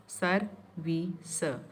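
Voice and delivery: "सर, वी, स" slowly, each syllable on its own. Shown as a demonstration. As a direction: split service into "सर", "वी", "स" with gaps between. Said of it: "It can be broken into three syllables".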